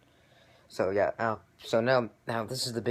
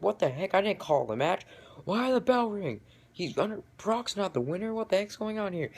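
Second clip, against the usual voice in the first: confused referee; 11 semitones higher than usual. Retold or imitated. Referee questioning why the bell rang, high and confused. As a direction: play the baffled referee protesting. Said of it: "The referee's like".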